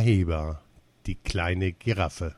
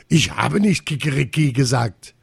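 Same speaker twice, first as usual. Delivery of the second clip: indignant, higher-pitched dignified denial; dignified protest; 5 semitones higher, 4 dB louder than usual. A narrator's reading. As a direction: deny it with wounded dignity. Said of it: "erklärte Rinaldo würdevoll".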